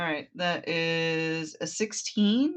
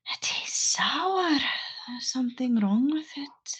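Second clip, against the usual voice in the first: character voice; 8 semitones higher than usual. Acted. Plays Aang disgusted by tainted blood.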